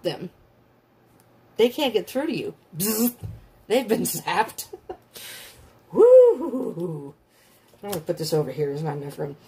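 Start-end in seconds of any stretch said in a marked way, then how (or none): 2.8-3.1 imitated electric zap; buzzing zap. Shown as a demonstration. 5.9-7.1 loud, higher-pitched woo; excited whoop. Real, not acted. Exclaims after the zap.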